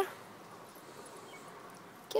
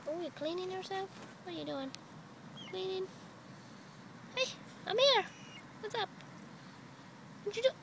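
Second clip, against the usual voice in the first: soft cooing; 8 semitones higher, 10 dB quieter, faster than usual. Real, not acted. Softly cooing at grooming bunny.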